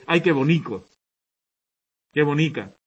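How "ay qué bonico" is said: affectionate regional "bonico"; Murcian diminutive. Shown as a demonstration. Teacher demoing a Murcian endearment, affectionately. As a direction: say "bonico" with the Murcian -ico ending, fondly. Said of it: "donde ellos usan este su, este diminutivo ico".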